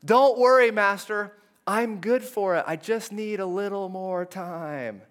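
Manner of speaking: desperate. Acted. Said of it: "in his desperation, he goes".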